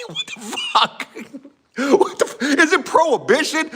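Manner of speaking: high, laughing disbelief; incredulous laughing outburst. Retold or imitated. Painter retells his shock at a five-dollar offer, incredulous and laughing. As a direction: drag out a stunned reaction through laughter.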